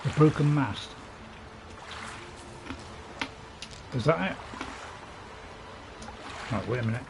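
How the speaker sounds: chewing sweets while talking; words round a crunchy mouthful; crunching sweets. Real, not acted. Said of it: "Sorry about the, uh, the crunching".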